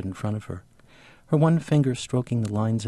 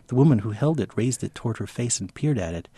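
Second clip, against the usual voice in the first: ordinary talk, nothing marked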